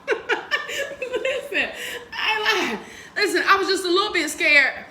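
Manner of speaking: high words broken by laughter; laughing while talking. Real, not acted. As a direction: keep laughing as you talk. Laughing through the story.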